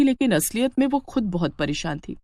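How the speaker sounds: usual speaking voice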